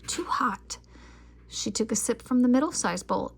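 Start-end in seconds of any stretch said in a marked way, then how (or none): 0.1-0.8 breathy whispered complaint. A narrator's reading. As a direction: whisper it, wincing. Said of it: "she cried".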